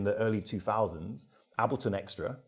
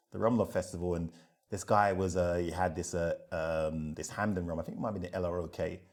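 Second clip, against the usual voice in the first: normal talking voice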